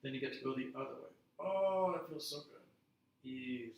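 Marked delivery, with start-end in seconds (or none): 1.4-3.7 blissful sigh of relief. Shown as a demonstration. Instructor savouring the stretch.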